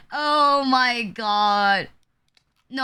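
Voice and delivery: ordinary talk, nothing marked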